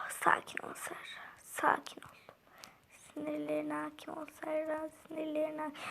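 hushed, telling herself to calm down; whispered self-calming. Real, not acted. Girl calming herself mid-race, whispered. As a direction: breathe it to yourself under your breath.

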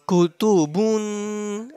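Kutubun slow, the final n held in the nose; ikhfa, nasal. Shown as a demonstration. Teacher showing students how to hide the sound, nasal ikhfa. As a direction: hum out the end of Kutubun nasally. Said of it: "like this".

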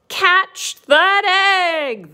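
slow, high, loud storybook cry; long drawn-out acted shout. A narrator's reading. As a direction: stretch out the alarmed call as Cow. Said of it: "the cow cried out".

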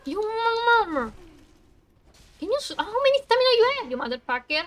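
loud, high-pitched outburst of swearing; angry gamer rage. Real, not acted. Streamer cursing a boss with endless stamina, furious shouting. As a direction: yell furiously at the enemy, voice jumping up. Raging at game enemy mid-fight.

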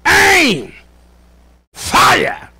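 high, shouted commands with long pauses; firing squad commander. Acted. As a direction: call out each order to the squad. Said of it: "And then somebody says".

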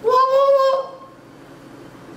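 stunned disbelief. Real, not acted. Host reacting to a unique jerk chicken, stunned disbelief. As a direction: jump up in shocked outrage.

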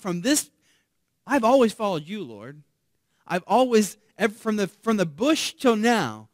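loud, high, pressing plea to God; bold Moses pleading. Retold or imitated. Moses boldly pressing the Lord for the plan, loud plea. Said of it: "Moses very boldly here says".

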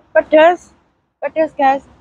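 talking through a burning mouth; mouth burning from spicy food. Real, not acted. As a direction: chili heat, struggling to speak. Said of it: "Nggak bisa ngomong apa-apa, kepedesan".

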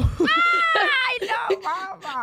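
high shrieking gasp then a drop; shocked squeal. Real, not acted. Reacting to her friend's wild story, shrieking. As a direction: squeal in disbelief.

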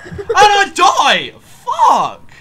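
very high, drawn-out furious yell; real gamer meltdown; raging scream. Real, not acted. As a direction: scream the death and curse at full volume. Gamer dies, rages.

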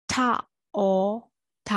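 "taught" split into ထ, အော်, ထ with gaps; isolated sounds ထ, အော်, ထ, each on its own; sound-by-sound breakdown. Shown as a demonstration. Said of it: "အတန်အတွက်ကအဲ့လိုလေးဖြစ်ပါ".

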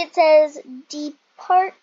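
ordinary talk

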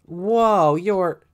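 high, loud startled gasp; surprised. Acted. Kids gasp at the flashy rival, loud surprise. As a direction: react in awe.

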